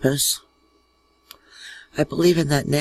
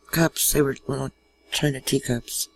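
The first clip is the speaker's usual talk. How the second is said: normal talking voice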